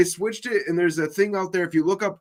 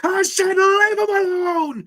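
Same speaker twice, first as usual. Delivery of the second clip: high, loud and slow yelling; angry movie shout; 6 dB louder, slower than usual. Retold or imitated. Reenacting the angry roommate scene, slow high shout. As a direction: bellow it at the roommate. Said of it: "that was the name I was looking for".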